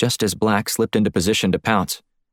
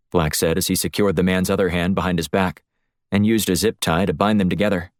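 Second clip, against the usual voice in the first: ordinary delivery, nothing marked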